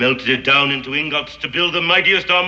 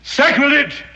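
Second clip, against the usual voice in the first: sharp, higher outraged exclamation; scandalised outburst; outraged cry; 8 semitones higher, 4 dB louder, slower than usual. Acted. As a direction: cry out at the blasphemy. Outraged at plan to take gold.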